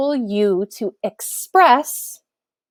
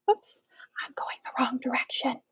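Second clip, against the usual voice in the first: whispered aside; 2 semitones higher, 13 dB quieter than usual. Real, not acted. Aside after slide mistake.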